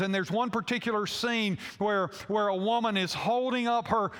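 normal talking voice

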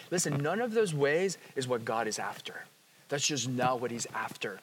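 hushed, unvoiced whisper to the congregation. Real, not acted. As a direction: lean in and whisper it. Sudden whisper in sermon.

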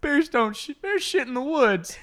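high, loud cartoon bear voice; indignant comic bear. Jailed bear on bathroom habits, high comic protest.

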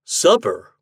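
growled. A narrator's reading. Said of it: "growled Ashton".

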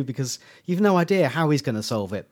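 ordinary talk

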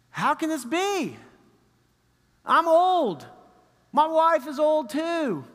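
put-on high, incredulous old man; doubting Zechariah. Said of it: "what does Zechariah do".

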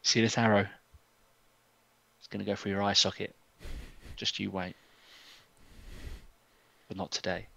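very soft, low, menacing delivery; quiet threat. Acted. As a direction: intimidate him under your breath. Ilmarin quietly threatens the guard.

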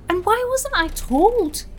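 high, slow, loud indignation; indignant outburst. Acted. Joan alone, stung at being kept in the dark, indignant. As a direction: demand an answer nobody gave you. Joan upset about friend's jailing.

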